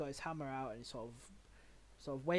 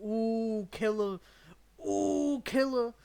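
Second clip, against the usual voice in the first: high, loud drawn-out ooh; mock cheering; 9 semitones higher, 15 dB louder, much slower than usual. Game master eggs on the attack, high mock cheer. GM mimics excited chant.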